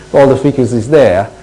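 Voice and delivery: ordinary talk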